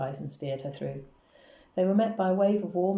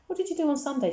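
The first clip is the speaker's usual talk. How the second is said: high, lively call; mildly projected schoolgirl's question; 11 semitones higher than usual. A narrator's reading. Schoolgirl greeting a friend at the gates, called out. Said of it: "she called".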